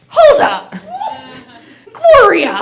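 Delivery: high, loud outburst at an offender; mock-outraged holler; indignant shout. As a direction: call her out, pitch leaping up. Acting out her indignant retort.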